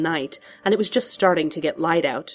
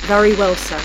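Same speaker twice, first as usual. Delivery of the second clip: raised voice through radio static; faraway butler on a failing link; far away, static; 3 semitones higher, 7 dB louder than usual. A narrator's reading. Butler accepts the order, far away through static. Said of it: "Alfred sounded very far away now".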